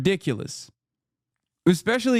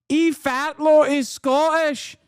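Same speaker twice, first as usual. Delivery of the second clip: Scottish impression climbing high and drawn out; mock Scottish accent; 9 semitones higher, slower than usual. Streamer answering a chatter who doubts the accent, mock Scottish accent. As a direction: insist in an exaggerated Scottish accent. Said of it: "that Scottish, my guy".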